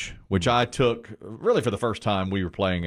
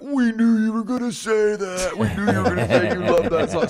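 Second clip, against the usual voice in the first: higher, laughing teasing chant; laughing kids; 9 semitones higher, 4 dB louder than usual. Retold or imitated. Kids in the car teasing dad, higher and laughing. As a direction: gloat while cracking up. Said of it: "they're like".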